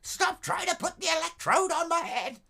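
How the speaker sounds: high, loud frantic patient voice; high mock asylum shriek; screaming asylum patient. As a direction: shriek like a patient being wired up.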